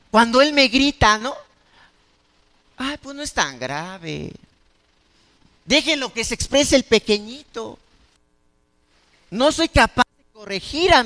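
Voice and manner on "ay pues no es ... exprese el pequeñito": mock indulgent sigh. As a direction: brush it off sweetly. Indulgent parent excusing shouting. (padre consentidor, suave y lento, en burla)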